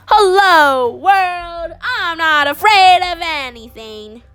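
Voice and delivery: high, ringing "Hello, world" with long vowels; triumphant proclamation. Acted. Freshly cut boy greeting the world, triumphant call. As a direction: announce yourself to the whole world.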